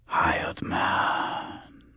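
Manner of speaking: eerie, drawn-out hoarse whisper; voiceless rasping whisper. Acted. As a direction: whisper it hoarsely, letting the breath trail. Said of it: "a hoarse whisper".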